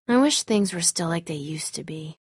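soft whispered longing; hushed, wistful whisper; sad whisper. Acted. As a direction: whisper it to yourself, sadly. Grieving after friend's death.

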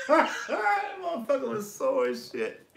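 dragged out, high-pitched, laughing through every word; slow laughing words. Real, not acted. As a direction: barely get it out through laughter.

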